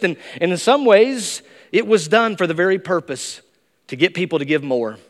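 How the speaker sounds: ordinary talk, nothing marked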